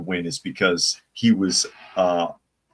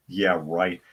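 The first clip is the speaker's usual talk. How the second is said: ordinary talk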